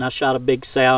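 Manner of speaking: ordinary talk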